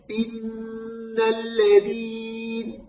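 "إن الذين" بكسرة همزة قصيرة وغنّة طويلة على النون; رتّل "إن الذين" ببطء دون ياء بعد الهمزة; كسرة قصيرة مع غنّة. Shown as a demonstration. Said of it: "الكسرة للهمزة". (تلاوة "إن الذين" ممدودة الغنّة قصيرة الكسرة)

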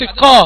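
"قا" released as qaf with an open a; voweled qaf. Shown as a demonstration. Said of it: "قلت قا بعديها أداة المتحرك". ("قا" قاف متحركة بالفتح)

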